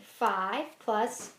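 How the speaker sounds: very slow, spelled-out sum; slow choral count. Shown as a demonstration. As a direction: lead the class word by word. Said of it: "So say it with me".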